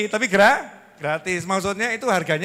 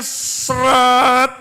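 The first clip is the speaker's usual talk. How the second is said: drawn-out tightness; 4 semitones higher, 5 dB louder than usual. Shown as a demonstration. Mimics tightness of heart.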